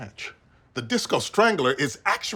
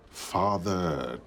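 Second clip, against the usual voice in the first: ominous; 10 semitones lower, 6 dB quieter, much slower than usual. Acted. Brooding dramatic pronouncement.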